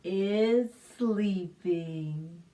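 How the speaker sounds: gentle hushed lullaby reading; soothing bedtime. Acted. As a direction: settle the children to sleep. Calm bedtime ending.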